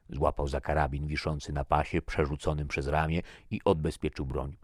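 ordinary delivery, nothing marked

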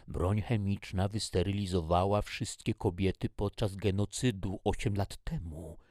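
ordinary delivery, nothing marked

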